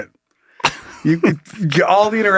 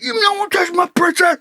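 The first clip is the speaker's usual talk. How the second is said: high-pitched mock shout; hammed-up protective father screaming; raging dad; 12 semitones higher than usual.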